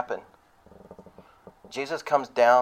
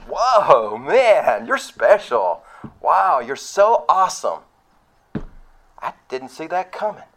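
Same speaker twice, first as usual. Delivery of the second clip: higher, louder sarcastic awe; God pretending to be wowed, dripping irony; mock impressed; 5 semitones higher, 5 dB louder, slower than usual. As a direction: fake amazement, tongue in cheek. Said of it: "and God's gonna go".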